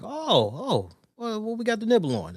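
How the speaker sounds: high, eager "oh, well"; mock eager. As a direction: sound keen for a snack. Eager for food he hears of.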